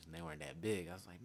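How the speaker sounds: normal talking voice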